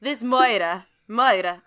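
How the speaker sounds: "murder" as "moy-duh", no r; Savannah drawl. Shown as a demonstration. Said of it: "I say it like that 'cause it happens in Savannah".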